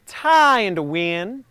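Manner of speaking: Tie in the wind drawn out slowly, rising on Tie; slow stretched phrase. Shown as a demonstration. Coach modelling the line for the class, slow. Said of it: "we're gonna say".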